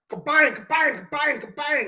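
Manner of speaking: high-pitched rhythmic "kabang" clanging; hammering noise. Retold or imitated. Mimicking the coworker wailing on the vise, clanging noise. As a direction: imitate hard hammer blows on metal. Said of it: "he's wailing on the vise".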